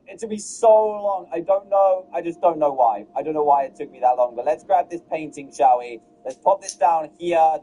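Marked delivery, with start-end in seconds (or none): none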